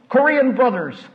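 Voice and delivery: calling out down the hall; mock yell. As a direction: call for help down a corridor. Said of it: "go out in the hall and yell".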